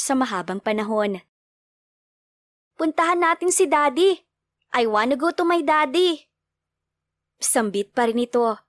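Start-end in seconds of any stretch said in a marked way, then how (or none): 2.8-6.3 put-on higher, pleading little kid voice; eager child. A narrator's reading. Child begging mother to go to daddy. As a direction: beg like a small child wanting daddy.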